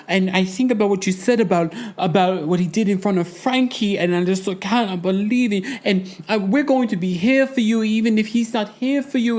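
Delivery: high mock gushing voice of Julia. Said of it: "She's just like".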